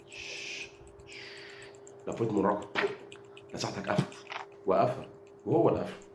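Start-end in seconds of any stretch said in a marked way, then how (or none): 0.1-1.2 long unvoiced shh to silence him; teasing hiss of a shush; taunting shush. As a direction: hush him up like a pest. Shushing the rival fan.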